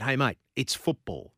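usual speaking voice

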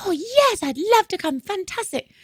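high-pitched, gushing yes; retold delighted acceptance. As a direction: imitate an overjoyed guest accepting. Teacher gladly accepts gig invitation.

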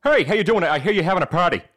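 Peter Griffin. Acted. Party crasher greeting the host at the door, cartoon impression. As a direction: put on a cartoon goofball's accent. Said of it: "in Peter Griffin accent".

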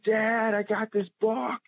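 high excited yell of Dad, Dad. Retold or imitated. Son running to his dad's stand, shouting about his buck, high and excited. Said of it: "I'm yelling".

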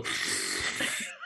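long, voiceless straw slurp; imitated slurping. Psychic showing how dad drained a milkshake, imitated slurp. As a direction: mimic noisily sucking a drink dry. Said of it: "the slurping and all that".

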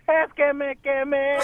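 quejido fingido, muy alargado; niño que llora de dolor, lento y exagerado; Piolín llorando. Acted. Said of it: "empieza a llorar más fuerte el Piolín". (lloriqueo lento de niño quemado)

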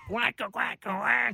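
high quacking, laughing through it; duck voice. Pretend. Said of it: "do us your eagle impersonation".

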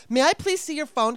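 high, loud, pointed mock-polite request; bitchy demand. Asking to see the tow guy's phone, bitchy. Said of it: "I was being such a bitch".

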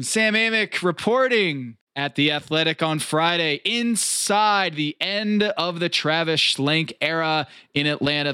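usual speaking voice

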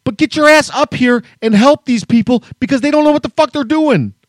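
furious thought about the missing manager; angry inner rant. Retold or imitated. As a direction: demand the manager show up. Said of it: "all I could think about was".

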